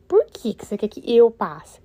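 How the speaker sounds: asking herself why she should pass at all; mildly exasperated rhetorical question; incredulous inner question. Retold or imitated. Inner thought, doubting husband's push.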